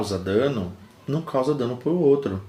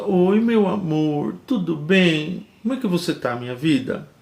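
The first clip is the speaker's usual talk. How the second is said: slow, drawn-out, higher-pitched lovey-dovey greeting; affectionate husband; 4 semitones higher, slower than usual. Acted. Husband greeting his wife with pet names, acted affectionate. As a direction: coo it sweetly, stretching the endearments. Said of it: "um jeito mais carinhoso".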